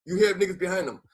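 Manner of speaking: ordinary talk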